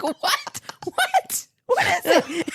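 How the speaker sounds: very high, rising, frantic questions; retold panic. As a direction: demand to know the danger in a laughing panic. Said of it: "She got me so scared".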